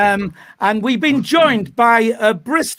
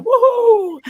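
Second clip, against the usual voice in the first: cheer; 5 dB louder than usual. Real, not acted. Host cheers the editor.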